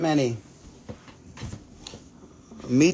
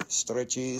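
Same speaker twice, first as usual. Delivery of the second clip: much softer, lower "stretchy"; low quiet stretchy; 6 semitones lower, 10 dB quieter than usual. Shown as a demonstration. Seller playing with word stretchy, low and soft.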